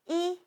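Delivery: Yi held high and level; first-tone Yi, high and steady. Shown as a demonstration. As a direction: sing Yi on one high flat pitch. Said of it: "is the first tone, high level tone".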